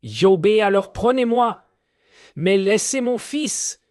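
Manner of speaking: high, pleading shout; crying out. Acted. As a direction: beg the spirit at the top of his voice. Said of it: "Armel crie". (cri suppliant et aigu)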